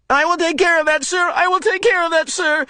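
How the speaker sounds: high, mocking imitation of the speaker voice; boy mocking the wall speaker, cruelly sarcastic; cruel sarcastic mimicry. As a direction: sneer it back in a mock servile voice. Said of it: "mimicked in a cruel, sarcastic voice".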